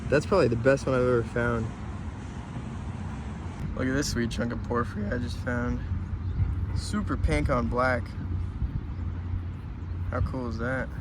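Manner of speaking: ordinary talk, nothing marked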